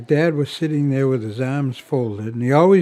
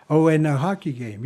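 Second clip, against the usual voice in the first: ordinary talk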